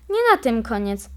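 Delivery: ordinary delivery, nothing marked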